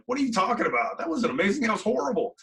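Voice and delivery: high, incredulous pushback at the praise; can't believe they're calling it amazing; disbelieving protest. Retold or imitated. Answering people who praised his speech, high incredulous protest. Rejects praise after the contest.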